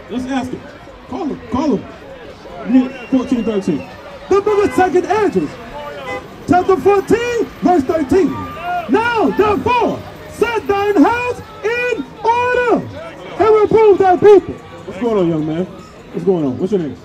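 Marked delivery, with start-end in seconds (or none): 4.3-14.5 booming scripture proclamation; shouted scripture reading. Acted. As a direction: proclaim the verse to the whole street. Scripture read aloud to crowd.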